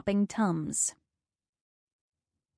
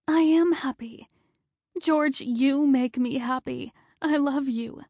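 higher and pleading; tearful; 6 semitones higher than usual. Acted. Wife reassuring her dying husband, higher and tearful. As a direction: reassure him through tears.

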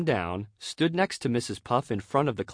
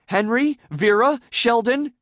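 high, loud, grasping at names; desperate calling out; 11 semitones higher, 7 dB louder than usual. A narrator's reading. Teacher avoiding one student's name, calling out desperately. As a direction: call each name in rising desperation. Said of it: "she called out in desperation".

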